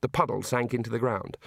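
ordinary delivery, nothing marked

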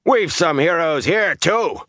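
high, rough shouted jibe; rough-voiced, high-pitched heckle; loud, rough voice. A narrator's reading. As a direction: call it out roughly to the crowd. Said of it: "said in a loud, rough voice".

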